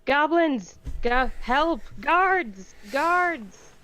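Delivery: high, loud panicked yelling; frantic cry for help; screaming for help. Acted. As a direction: scream for the guards like you're being chased. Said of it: "just screaming".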